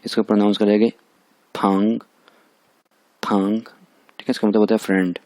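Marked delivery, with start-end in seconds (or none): 1.5-3.6 फंग with a rising tone; second tone. Shown as a demonstration. Teacher pronouncing the example word फंग, second-tone rise. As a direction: lift the pitch through फंग. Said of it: "इस पे second tone लगी हुई है".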